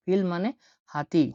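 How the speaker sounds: ordinary talk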